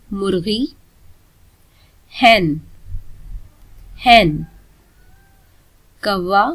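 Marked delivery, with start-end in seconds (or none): none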